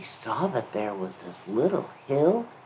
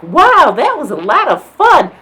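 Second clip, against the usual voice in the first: high, loud excited voice; bursting to tell friends the adventure; thrilled kid; 13 semitones higher, 13 dB louder than usual. A narrator's reading. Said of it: "Dinky's like".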